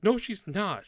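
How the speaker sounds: put-on high, loud little-boy retort. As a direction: contradict like a small brother. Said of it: "And the little boy".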